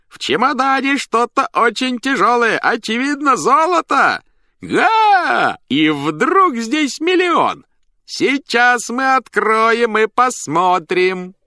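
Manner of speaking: high, loud clown patter, stretched out; shouting over roar. A narrator's reading. Clown tells the audience about his inheritance, shouting. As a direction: project every word shrieking-high over a noisy crowd. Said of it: "стараясь перекричать рёв".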